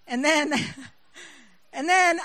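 giggling through the words; laughing. Real, not acted. Preacher retelling a home mix-up, laughing through it. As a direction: laugh as you stall the story.

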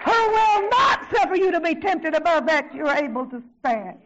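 shouted scripture, punching NOT; forceful preaching. Real, not acted. Preacher quoting the promise to the congregation, forceful shouting. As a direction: cry out the verse with full conviction.

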